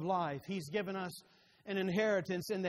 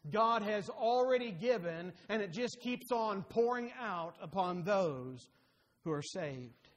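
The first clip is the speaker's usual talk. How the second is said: ordinary delivery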